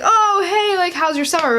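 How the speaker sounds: bright high hello, the greeting she meant to give; cheery greeting. Retold or imitated. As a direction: chirp it up high like a cheerful first day hello. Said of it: "I would be like".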